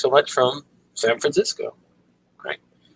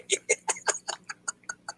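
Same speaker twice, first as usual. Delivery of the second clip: quiet, breathy chuckle; soft wheeze of laughter; 11 dB quieter than usual. Real, not acted. After telling viewers the mermaid joke, laughing.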